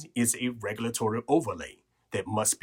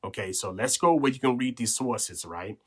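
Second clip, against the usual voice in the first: normal talking voice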